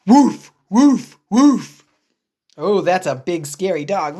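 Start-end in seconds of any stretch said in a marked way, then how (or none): 0.1-1.8 loud woof woof bark; dog barking. Acted. The scary dog barking at Piggy: loud barks.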